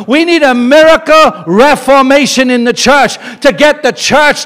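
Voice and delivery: preaching at full force. Real, not acted. Preaching climax to congregation.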